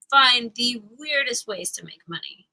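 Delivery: normal talking voice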